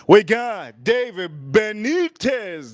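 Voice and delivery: high, slow, stretched-out name announcement; announcing a name. Real, not acted. Streamer welcomes a super chat sender, announcing grandly.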